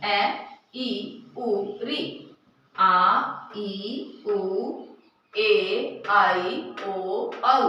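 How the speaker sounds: ए ई उ री quick and clipped, then आ ई उ ए आई ओ औ each held out long; teacher timing short versus long vowels aloud. Shown as a demonstration. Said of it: "ज्यादा समय लग रहा है".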